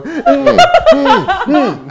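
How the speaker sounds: loud, high mock "mm" sounds; imitated objecting hums. Imitating her when the blue cheese starts, objecting hums. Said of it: "she start going".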